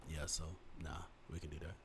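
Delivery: very low, very soft relaxed voice; chill low voice. Shown as a demonstration. Showing his relaxed mood voice, very low and soft. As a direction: drop it deep and lazy. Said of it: "when I'm chilling, I'm really like".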